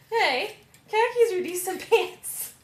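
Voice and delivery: high, loud objection breaking into laughter; laughing protest. Real, not acted. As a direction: protest playfully while cracking up.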